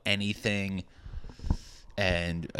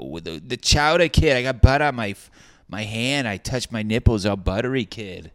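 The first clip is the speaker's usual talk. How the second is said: put-on loud, higher Boston accent; hammed-up Boston accent, louder and higher; mock a brash Boston local; 4 semitones higher, 8 dB louder than usual. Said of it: "Everybody talks like that. It's the most worst accent".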